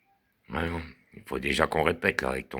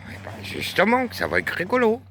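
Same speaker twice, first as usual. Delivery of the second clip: squeaky joke voice. Pretend. Bass joking about soprano air.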